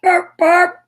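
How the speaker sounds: high, loud bark-bark horn; little car's cheerful bark-bark horn. Acted. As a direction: honk it out like a toy car. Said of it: "went Noddy's little car".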